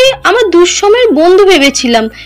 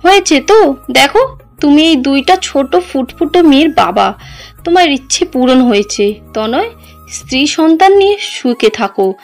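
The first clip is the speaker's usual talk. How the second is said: suppressed pain; 3 semitones higher than usual. Acted. Heartbroken wife bids husband farewell, pain held in. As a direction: hold the hurt down as you let him go. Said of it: "সব কষ্ট চেপে নিয়ে উত্তর দেয়".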